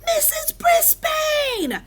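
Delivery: put-on very high, slow child's shout. A narrator's reading. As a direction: call it out high, stretching each word. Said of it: "Small Paul shouted".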